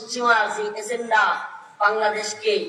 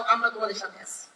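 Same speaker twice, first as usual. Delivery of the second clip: softer, gentle reassurance; soft promise; 3 semitones lower, 6 dB quieter than usual. Acted. Preacher assuring the youth he stands with them, soft. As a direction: say it quietly, almost to yourself.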